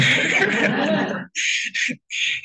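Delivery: a high, breathy burst of laughter; real laugh at the odd coincidence; laughing it off mid-story. Real, not acted.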